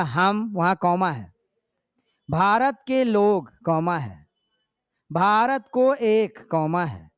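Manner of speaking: slow, with a clear pause at each comma; pausing at commas. Shown as a demonstration. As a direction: stop at every comma, then go on. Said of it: "आप उच्चारण ऐसे बच्चे को सिखाना है आपको।".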